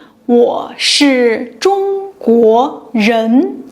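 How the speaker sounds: 我是中国人 said slowly, each syllable clear; slow model Mandarin. Shown as a demonstration. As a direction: model the sentence slowly for students. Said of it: "I say".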